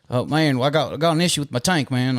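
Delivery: high imitated country caller; Southern caller. Customer phoning about a tank issue, high Southern twang.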